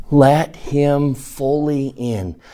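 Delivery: slow, drawn out, pleading; slow earnest plea. Real, not acted. Preacher urging his listeners to let Jesus in, slow plea. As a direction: beg them gently to open up. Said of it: "preacher pleads slowly".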